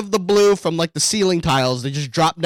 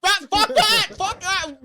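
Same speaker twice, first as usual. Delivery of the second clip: high yelled outburst. Retold or imitated. Mock heckler shouting back.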